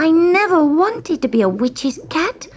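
high, plaintive little cat voice; upset kitten, pitched right up; protesting kitten. A narrator's reading. Kitten protests against being witch's cat.

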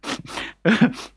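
light high giggle; girlish giggle. Acted. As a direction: giggle like a teasing girl. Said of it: "she started giggling".

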